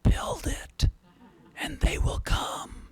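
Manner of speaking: slow, haunting stage whisper; creepy whisper. Acted. Lecturer imitating the film's haunting voice for the audience, creepy whisper. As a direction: whisper it like an eerie disembodied voice. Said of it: "this rather creepy voice whispering".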